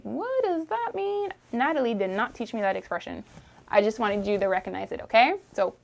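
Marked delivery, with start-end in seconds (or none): 0.0-1.3 high, quiet puzzled question; confused traveler. Retold or imitated. Traveler in Montreal hearing slang, mock confused. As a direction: play a baffled tourist. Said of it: "and you're like".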